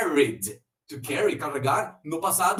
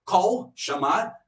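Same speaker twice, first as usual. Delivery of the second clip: ordinary delivery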